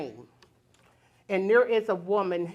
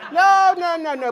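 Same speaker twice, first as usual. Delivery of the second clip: much louder, higher laughing no; laughing refusal; 10 semitones higher, 9 dB louder than usual. Real, not acted. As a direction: laugh out an emphatic no. Laughing refusal of more kids.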